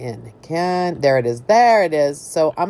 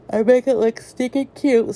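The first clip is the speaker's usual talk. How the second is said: higher, stretched out through a real yawn; 7 semitones higher than usual. Real, not acted. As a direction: say it mid-yawn. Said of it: "I don't know why I'm yawning".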